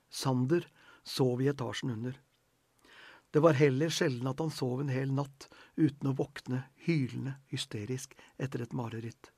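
usual speaking voice

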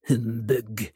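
grumpy mutter through pursed lips; muttered to himself. A narrator's reading. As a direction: mumble it like a sour old miser. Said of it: "mumlet han for seg selv gjennom de spisse leppene". (gretten mumling med spisse lepper)

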